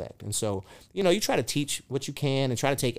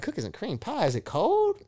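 "Cookies and cream pie?" with the pitch shooting high on "cold"; mock disbelief, voice jumping up; incredulous; 9 semitones higher than usual. Dad questioning his son about the weird pie, high incredulous.

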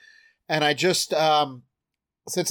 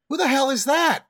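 startled; 9 semitones higher than usual. Acted. Character startled.

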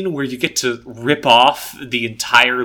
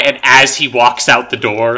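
usual speaking voice

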